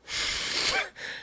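blowing hard into an inflatable; imitated huffs of breath; puffing noise. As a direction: mimic blowing up a raft. Imitates dad blowing up the boat.

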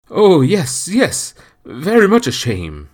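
gloating rough seaman; gleeful sailor. A narrator's reading. Stranger voiced in story.

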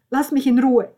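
high, very loud snap; angry rebuff. Acted. Roberta pulls away from Alessandro, shouting angrily. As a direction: shout them away from you.

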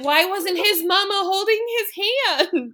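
high imitated little-girl wail; hammed-up tearful plea, rising high; mock child crying harder. Daughter asks mom about the turkey's mama, mock crying. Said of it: "she starts crying even harder".

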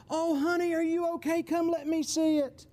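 high-pitched cooing concern, put on; hammed-up tender parent; doting parent. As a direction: fuss over a hurt child in a high voice. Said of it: "their attitude was probably not like".

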